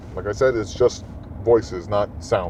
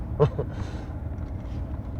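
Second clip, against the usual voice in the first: soft airless laugh; breathy laugh; 4 semitones higher, 9 dB quieter than usual. Real, not acted. Laughs at own example.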